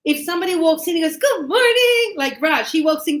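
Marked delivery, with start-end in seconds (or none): 1.2-2.1 high, loud, exuberant good morning; happy greeting. Retold or imitated. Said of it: "Like, he's happy".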